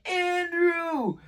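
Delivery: high, drawn-out call; yelling a name. Retold or imitated. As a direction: holler for a friend outside. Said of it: "I was just yelling".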